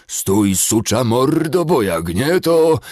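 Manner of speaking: loud, slow snarling dwarf threat; angry dwarf. Acted. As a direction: growl it like a raging dwarf. (wściekły krasnolud)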